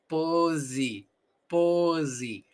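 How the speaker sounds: "Pose" as PO-zi, long first vowel; voiced S. Shown as a demonstration. Teacher repeating "Pose" to show the S as Z. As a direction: buzz the S in "Pose", end on I. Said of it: "¿Has notado la S?".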